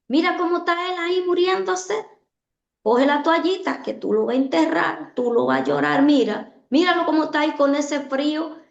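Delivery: tempter's urgent coaxing; taunting inner voice. Retold or imitated. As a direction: goad her toward despair. Enemy's taunting voice again.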